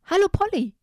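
high, bright-voiced calling out to a friend; cheery chameleon greeting. Chameleon greeting the parrot Polly, high cheery call. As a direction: call up to the parrot with a big hello.

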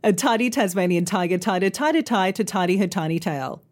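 rattled off quickly in one run; fast tongue twister. Shown as a demonstration. Teacher showing how speed makes it harder, fast. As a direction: race through the tongue twister without pausing. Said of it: "harder the faster you say them".

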